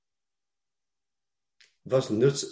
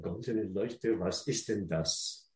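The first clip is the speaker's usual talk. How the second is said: much softer, low embarrassed question; ashamed mutter; 3 semitones lower, 11 dB quieter than usual. Pretend. Ashamed congregation asking what golden lampstands are, softly. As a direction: mumble it as if hiding in shame.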